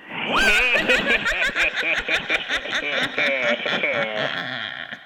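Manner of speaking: high cackling witch laugh; witch cackle. Acted. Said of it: "I'll be the witch".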